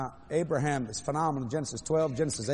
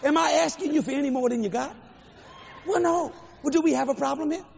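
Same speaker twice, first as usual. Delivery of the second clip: confronting challenge; 9 semitones higher than usual. Retold or imitated. Preacher voicing God's challenge to him, high confronting tone.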